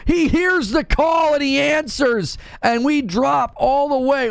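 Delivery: high, slow hype shout; big, drawn-out hype call; excited shout. Real, not acted. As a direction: yell it out like a stream alert going off. Hyping a big gift bomb.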